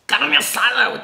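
retold excited food order; eager exclamation. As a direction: blurt it like a craving. Friend's eager food choice, acted out.

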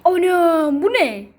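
loud, high startled cry; shocked exclamation. Real, not acted. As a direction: gasp at what you see. Opens oven, shocked. (şaşkın bağırış)

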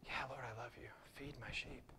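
whispered reply; hushed Peter. Retold or imitated. Peter answering Jesus in a humbled whisper. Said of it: "He jumps in and starts swimming".

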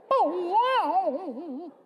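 high, loud cartoon "oh, wow"; eyes-popping cartoon gasp; cartoon amazement. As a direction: do a goggle-eyed cartoon reaction. Cartoon characters' eyes pop out.

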